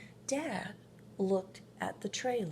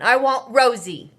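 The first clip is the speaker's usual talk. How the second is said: put-on loud, higher stubborn child voice; stubborn little boy; 4 semitones higher, 18 dB louder than usual. A narrator's reading. Marvin refusing his mom's kitten, loud and stubborn. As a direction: dig in like a sulking kid.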